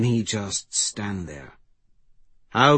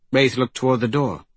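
ordinary delivery, nothing marked

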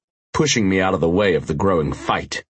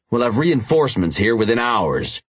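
ordinary delivery, nothing marked

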